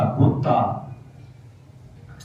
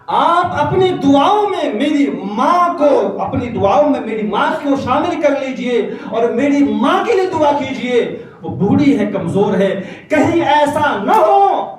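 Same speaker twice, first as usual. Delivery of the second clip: pleading son; 12 semitones higher, 5 dB louder than usual. Acted. Bayazid begging his teacher to pray for mother, high and pleading. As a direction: beg the teacher with feeling.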